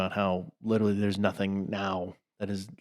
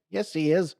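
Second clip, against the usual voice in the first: mock sketch voice; 8 semitones higher than usual. Quoting the sketch.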